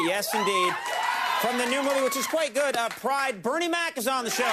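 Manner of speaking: high, loud guest introduction; announcer shout. Acted. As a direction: belt out the big intro.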